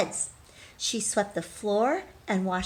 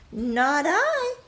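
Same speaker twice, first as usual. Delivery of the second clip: cat voice; 7 semitones higher, 4 dB louder than usual. Acted. Cat's refusal in the story.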